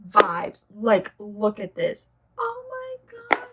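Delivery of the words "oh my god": awestruck. Real, not acted. Opening the beautiful box.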